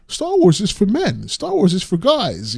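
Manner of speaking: high-pitched mock quote; put-on high voice for someone else's claim; imitating Asmongold. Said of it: "And Asmongold was saying".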